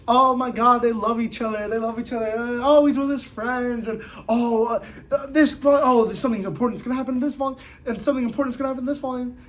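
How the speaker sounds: high, fast giddy fangirl rush; breathless high giddy babble; excited gushing. Retold or imitated. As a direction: gush over the covers in a rising flurry. Acting out excited cover browsing.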